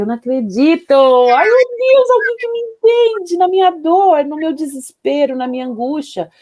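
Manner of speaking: high, loud cry of disbelief, easing off; reenacted emotional outburst, soaring then falling; tearful relieved outcry. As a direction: relive crying out to someone who finally understands. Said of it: "eu comecei a chorar, eu abracei ela e falei".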